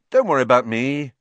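put-on casual brush-off; would-be offhand. A narrator's reading. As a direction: feign nonchalance, a bit too loudly. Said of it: "what he hoped was an off-hand voice".

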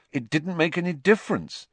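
put-on bitter boy's protest, pitched high; Harry, bitter. A narrator's reading. Harry rejects the praise, bitterly. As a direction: throw it out bitterly, high-voiced. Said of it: "said Harry bitterly".

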